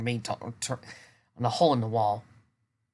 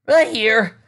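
groaning; 10 semitones higher, 10 dB louder than usual. Acted. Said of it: "Pierre groaned".

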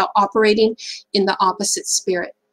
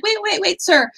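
much higher, quick "wait, wait, wait"; urgent plea; 9 semitones higher than usual. Retold or imitated. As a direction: stop him in his tracks, politely. Barista stops the angry driver.

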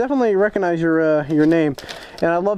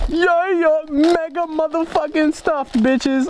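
much higher, drawn-out hype voice; hyped; 8 semitones higher, slower than usual. Real, not acted. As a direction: celebrate the haul like a win. Excited over a stuffed package.

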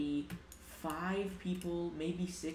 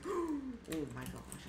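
startled gasp; 8 semitones higher, much slower than usual. Real, not acted. Nearly died in fight.

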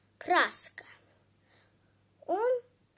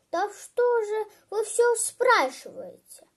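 higher, louder exasperated question; irritated boy; 7 semitones higher, 7 dB louder than usual. Acted. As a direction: burst out, fed up with being questioned.

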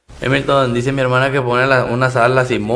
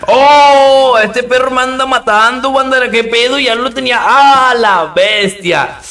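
grito agudo y fuerte de sorpresa; alarma real; 8 semitones higher, 4 dB louder, slower than usual. Real, not acted. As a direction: exclama alarmado, alargando los gritos. Sobresalto al casi morir jugando. (sobresalto a gritos)

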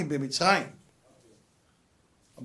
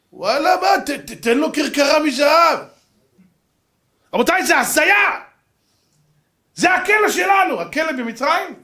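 high, loud indignant ranting; outraged shout; 9 semitones higher, 7 dB louder, slower than usual. Real, not acted. Outraged rant about prisons.

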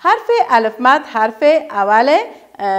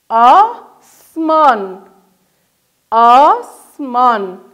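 drawn out into syllables; هجا به هجا و آهسته; slow syllable-by-syllable; 3 dB louder, much slower than usual. Shown as a demonstration. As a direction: model the word slowly for children. Teacher modelling syllables.

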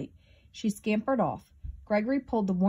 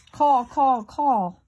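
loud, high crow caws; crow cawing; 6 semitones higher, 7 dB louder, much slower than usual. A narrator's reading. Crow makes a noise at Gregory's burrow, loud cawing. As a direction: caw it out forcefully, falling each time. Acting a crow's cawing in story.

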